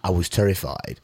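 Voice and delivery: usual speaking voice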